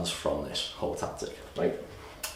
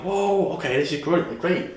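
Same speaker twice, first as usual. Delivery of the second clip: high excited customer reaction; mock delighted; 9 semitones higher than usual. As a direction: play the thrilled buyer. Customers impressed by the offer.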